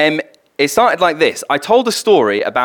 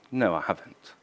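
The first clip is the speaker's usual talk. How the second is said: softer, small guilty admission; ashamed quiet no; 5 semitones lower, 12 dB quieter than usual. Retold or imitated. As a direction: own up quietly. Bill ashamed under questioning.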